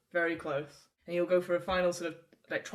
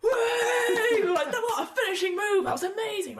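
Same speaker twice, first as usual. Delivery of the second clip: much higher, loud, laughing cheers; cheering crowd; 7 dB louder than usual. Acted. Crowd cheering the dwarf's finishing move, high excited shouts. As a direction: celebrate the knockout. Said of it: "you just hear people go".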